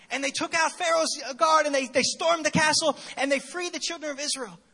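put-on loud, high, breathless boy bragging; excited kid storyteller. Little boy telling mom the Marines stormed the castle, excited and loud. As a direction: tell it like a kid who thinks it's an action movie.

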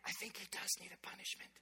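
put-on a small child's whisper from the dark. Older brother whispering to dad, hushed whisper. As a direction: whisper it like a sneaky little brother. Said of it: "I hear a whisper come out".

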